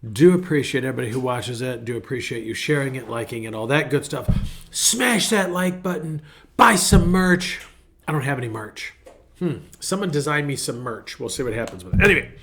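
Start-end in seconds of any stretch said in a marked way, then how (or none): 4.7-7.6 high, drawn-out promo shout-out; mock hype plug. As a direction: pitch it like a slow, pumped-up slogan. Mock hype call to viewers.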